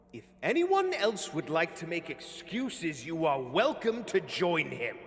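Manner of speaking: high, loud cold threats to his crew; loud menacing leader. Acted. Villain threatens diggers before leaving, loud menace. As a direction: command them with icy menace. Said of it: "the other voice, still loud".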